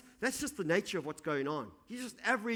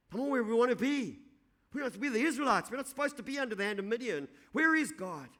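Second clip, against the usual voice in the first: frustrated complaint; 6 semitones higher, 3 dB louder than usual. Retold or imitated. Voicing Gideon's frustrated complaint.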